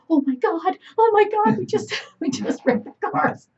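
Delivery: high, panicked oh my God; retold panic. Two kids who just crashed the cars, panicked. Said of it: "And we're like".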